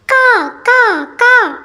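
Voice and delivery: high, cawing like a noisy crow; imitating a crow's caw. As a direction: caw it out like a crow outside the house. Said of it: "പുറത്ത് കാക്കകളുടെ ബഹളം".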